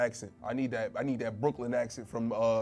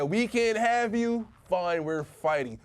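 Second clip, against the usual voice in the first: high, slow mock declaration; mock defiance; 8 semitones higher, 4 dB louder, slower than usual. Studio answers losing the director, mock defiance. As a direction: draw it out as a hammed-up challenge.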